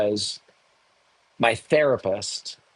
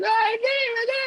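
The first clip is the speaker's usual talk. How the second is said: hammed-up high wail, much higher; comic falsetto sob to God; mock crying plea. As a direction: whimper at God like a melodramatic crier. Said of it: "I'm always very upset when I cry".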